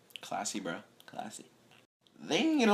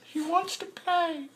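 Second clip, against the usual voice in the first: much higher, slow, softer; mock awed; 5 dB quieter, slower than usual. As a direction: gush it in awe. Watching Luck on the sideline.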